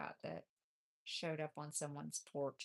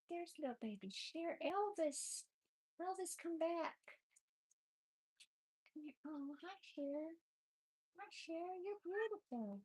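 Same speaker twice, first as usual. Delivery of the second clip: very high, sing-song voice for the babies; 12 semitones higher than usual. Real, not acted. Talking to the kittens in high baby talk.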